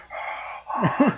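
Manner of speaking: breathy high gasp; mock gasp. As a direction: gasp through a mask. Imitating Dark Helmet's gasp.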